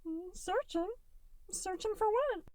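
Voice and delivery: a tiny blob kid, sweet and distracted; little gelatinous Goo. A narrator's reading. Little Goo putting off dinner, high and bright. Goo's voice.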